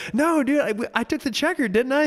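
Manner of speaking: high, unsure insisting; confused racer. Retold or imitated. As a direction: reach up high and plead it. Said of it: "He goes".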